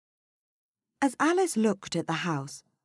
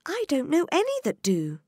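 put-on higher girl's voice; young Alice voice; 12 semitones higher, 4 dB louder than usual. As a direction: wonder it like a curious girl. Alice speaks.

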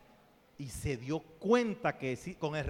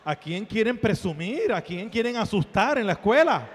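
loud, raised-pitch challenge; comic indignation; 4 semitones higher, 9 dB louder than usual. Acted. Speaker chides today's teachers at school, loud comic indignation. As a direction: fire off both questions in mock outrage.

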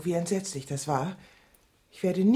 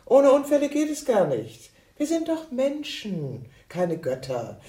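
heated insistence; 7 semitones higher than usual. Acted. Heated argument about accidents.

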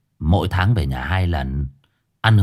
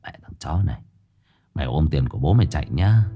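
grumbling under his breath, lower and softer; muttered curse; 6 semitones lower, 3 dB quieter, faster than usual. A narrator's reading. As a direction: mutter the insult to himself. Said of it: "lẩm bẩm mà chửi".

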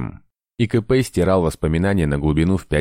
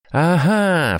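high, victorious aha; triumphant cry; 12 semitones higher, 3 dB louder than usual. A narrator's reading. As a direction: crow it out in triumph. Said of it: "вскричал инквизитор победительно". (победительно вскричав)

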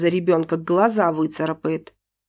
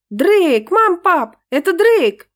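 high, loud joyful cry; excited shout; 10 semitones higher, 6 dB louder, slower than usual. Acted. Angelinka shrieking with joy at the intercom. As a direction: burst out with joy.

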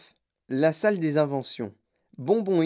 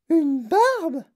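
put-on high, loud shocked girl's cry; 12 semitones higher, 5 dB louder than usual. A narrator's reading. Veruca shrieking at the idea of a beard. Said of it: "s'écria Veruca Salt".

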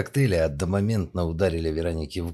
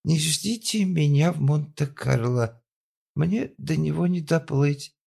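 moaning; 10 semitones higher, 3 dB louder, slower than usual. Acted. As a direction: groan it into the voicemail. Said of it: "простонала".

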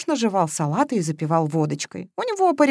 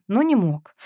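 ordinary delivery

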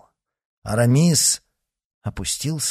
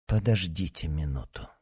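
softer, low murmur; quiet aside; 4 semitones lower, 7 dB quieter than usual. A narrator's reading. Aramis quietly to D'Artagnan, hushed. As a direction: lean in and say it quietly. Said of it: "проговорил тихо Арамис".

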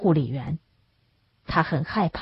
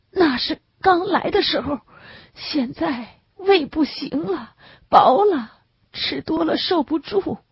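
tearful sick man; 10 semitones higher than usual. A narrator's reading. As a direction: plead it through a tight, weepy throat. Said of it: "嗓子带着哭腔". (带着哭腔的病人，声音高而慢)

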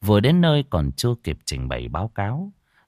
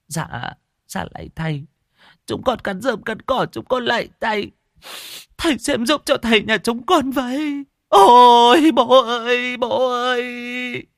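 much higher, wailing and sobbing; tearful pleading; 5 dB louder than usual. Acted. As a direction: daughters beg the shaman, weeping for their father. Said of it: "nước mắt rơi lã chã". (khóc lóc van xin, giọng cao, nức nở)

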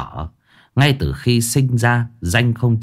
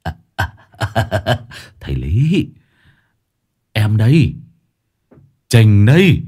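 sheepish laugh. A narrator's reading. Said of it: "cười hè hè".

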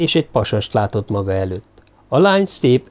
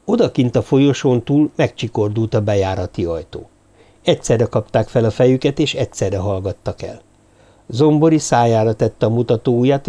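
ordinary delivery

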